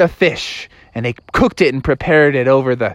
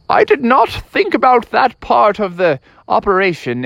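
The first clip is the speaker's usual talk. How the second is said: higher, put-on dad voice; flustered dad; 8 semitones higher than usual. Dad admits the car is upriver, high flustered voice. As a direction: own up to the oversight, pitched high.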